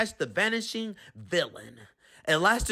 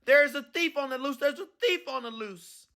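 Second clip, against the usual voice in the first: higher, urgent cry for help; mild mock shout of a clerk; shouting alarm; 6 semitones higher than usual. A narrator's reading. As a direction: raise the alarm across the store.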